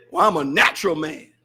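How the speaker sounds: mild mimic of a man dodging blame; imitating Brother Donald. Preacher retelling Brother Donald's excuse, low mock voice. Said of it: "the man say".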